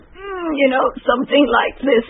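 strained voice. Shown as a demonstration. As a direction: squeeze the sound out, strained. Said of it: "a strained voice".

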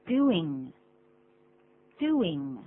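"Doing" with stressed DOO gliding into a short -ing; correct pronunciation model. Shown as a demonstration. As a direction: say "Doing" clearly for learners, no y glide. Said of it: "而是这么念的".

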